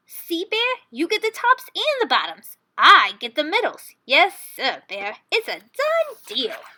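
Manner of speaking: high, pleased, slowing on the last words; sly rabbit sealing the deal; smug Hare. A narrator's reading. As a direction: savor the final trick. Hare's voice in story.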